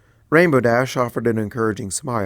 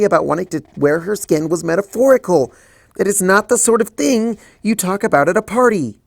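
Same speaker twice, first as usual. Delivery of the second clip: higher, louder, faster flustered objection; panicked protest; 8 semitones higher, 4 dB louder than usual. Acted. Twilight refusing the party in panic, flustered. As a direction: spiral into panic.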